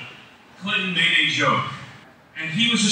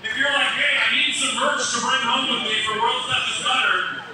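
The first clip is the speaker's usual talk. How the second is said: auctioneer patter; 5 semitones higher than usual. Acted. Charity auction selling blanket.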